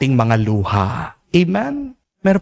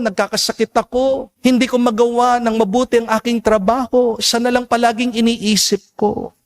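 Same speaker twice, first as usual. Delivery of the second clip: higher, faster, pleading rush of grief; grieving father pleading; 6 semitones higher, faster than usual. Retold or imitated. Grieving father pleads with the priest, higher and rushed. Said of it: "Sabi niya".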